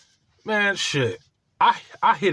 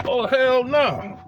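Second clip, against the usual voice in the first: mock disbelief; 7 semitones higher, much slower than usual. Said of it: "I'm like".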